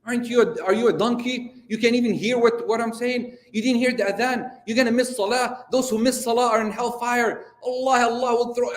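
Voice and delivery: retold angry shout mixing Arabic and English; harsh shout. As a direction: bark it down at the man in the tree. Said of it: "yeah, he shouted at him".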